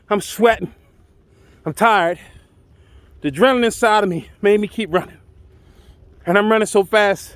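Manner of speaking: plain reading voice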